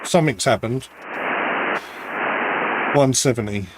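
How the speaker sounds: radio room static. Real, not acted. Off-mic, noisy radio room.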